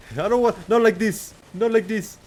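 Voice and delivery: high-pitched mock Indian accent, Apu-style; sing-song Indian accent like Apu quoting the Matrix. Streamer to chat, quoting The Matrix in mock Indian accent. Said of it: "that accent, whatever that is. Apu".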